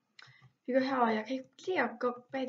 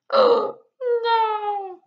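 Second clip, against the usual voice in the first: high, loud wailing oh no; shrieked oh no as it all goes wrong; anguished cry; 11 semitones higher, 12 dB louder than usual. Real, not acted. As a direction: cry out in real despair. Disaster in game.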